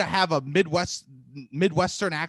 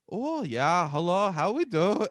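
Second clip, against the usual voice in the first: bad Midwestern accent, nearly Swedish Canadian; shaky-aim Midwestern accent sliding into Scandinavian; attempted Midwestern accent; slower than usual. Shown as a demonstration. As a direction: try a lilting Midwestern accent and miss. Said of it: "Can you do a Midwestern accent".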